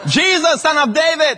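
high, loud call for help. Acted. Blind men calling on Jesus, high loud cry. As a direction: cry out to get his attention. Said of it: "they call on Jesus".

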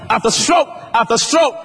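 high, loud, shouting sermon climax; preaching at full force. Acted. Preacher to congregation, loud impassioned high preaching. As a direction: thunder it out to the church like a revival preacher.

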